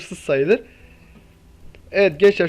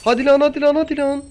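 higher, urgent pleading chant; excited urging; 7 semitones higher than usual. Real, not acted. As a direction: beg the crate, voice up. Urging the crate to pay out. (heyecanla yalvarır gibi, ince sesle)

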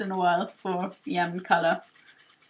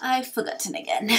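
put-on forgetful old sage, sheepish; slower than usual. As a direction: trail off, then own up sheepishly. Torkoal forgets once more.

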